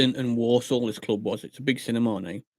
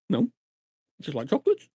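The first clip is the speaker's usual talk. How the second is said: ordinary delivery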